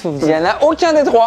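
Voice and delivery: high-pitched outburst; real high exclamation at a miss; exclaiming. Real, not acted. Contestant exclaims at a wrong answer.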